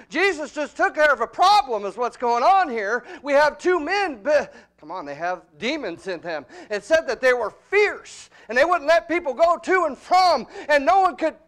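full-force pulpit preaching. Acted. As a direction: preach it hard to the congregation. Preacher shouts the passage.

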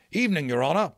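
a rough, good-humoured hello with a hint of chuckle; gruff chuckle. A narrator's reading. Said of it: "he said in a gruff chuckle".

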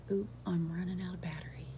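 hushed whisper outdoors so no one hears. Real, not acted. Whispering outside so the neighbors won't hear. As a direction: whisper it, no voice at all. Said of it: "Why am I still whispering?".

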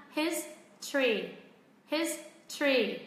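"His tree" as two separate, equal syllables; no stress. Shown as a demonstration. Teacher practises syllables before adding stress, "His tree" evenly split. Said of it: "đọc hoàn toàn không có trọng âm".

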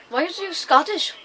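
loud, high mock-Scottish question; attempted Scottish accent. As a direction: ask it loudly in your best amateur Scottish. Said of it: "That is my best Scottish".

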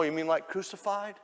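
ordinary delivery

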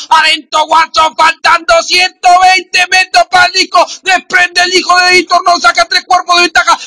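excited race finish call; horse race commentator. Real, not acted. Horse race call final stretch. (narrador hípico emocionado)

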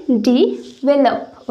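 डेवलप very slow as di-vel-up; correct pronunciation. Shown as a demonstration. Teacher repeating the right form slowly. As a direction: stretch डेवलप into three clear syllables. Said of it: "इसे क्या pronounce करेंगे".

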